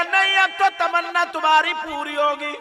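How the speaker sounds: ringing, sustained preacher's chant; full-force preaching. Retold or imitated. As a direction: preach it at full volume, stretching key words.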